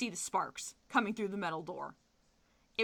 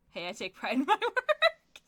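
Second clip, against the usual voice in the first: higher, louder, laughing brag of a crook; mock proud thief; 7 semitones higher, 7 dB louder than usual. Joking as the thief proud of his break-in, laughing brag. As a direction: play the cocky burglar, laughing.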